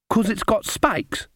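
put-on high, innocent child voice; sweet little girl.

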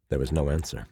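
ordinary delivery, nothing marked

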